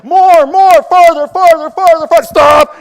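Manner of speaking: loud high shouts to guide the car back; shouting directions. Retold or imitated. Husband shouting to his wife as she reverses, loud.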